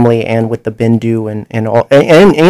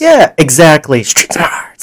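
whispering; 3 semitones higher, slower than usual. Real, not acted. Whispered agreement echo.

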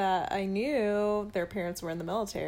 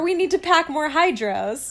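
higher, louder quoted line; raised voice for a mimicked line; imitated Canadian TV line; 7 semitones higher, 8 dB louder than usual. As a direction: quote it like a sitcom character. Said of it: "you don't notice until they say things".

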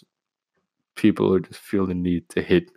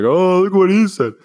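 high, loud tattling whine; mock outraged listener; 10 semitones higher, 8 dB louder than usual. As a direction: imitate someone making a scandal of it. Mocking an offended listener.

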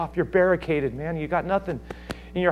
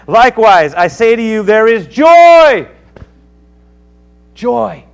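high, loud, drawn-out preaching; preaching climax; 10 semitones higher, 16 dB louder, slower than usual. Acted. Pastor building to the joy in heaven, slow and loud. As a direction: lift the room, stretch the last words.

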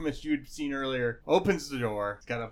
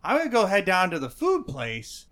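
tipsy gnome; 7 semitones higher, 7 dB louder than usual. Acted. Drunk gnome repeats line.

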